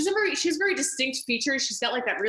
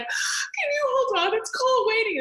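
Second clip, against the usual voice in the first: chirpy phone switch; 11 semitones higher than usual. Retold or imitated. Tennis player putting Jerry on hold, much higher and sing-song. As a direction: flip to a breezy high phone voice. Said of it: "and then she's like".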